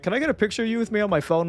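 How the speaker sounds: put-on old guy. Old man asking Deadpool for a photo, lightly voiced. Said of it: "this old guy comes up to me".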